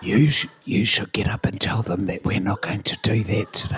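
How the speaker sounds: low, insistent whisper; really quiet. Retold or imitated. Colleague passes the job to his co-teacher, whispering. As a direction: whisper it as a furtive instruction. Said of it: "really quietly he said".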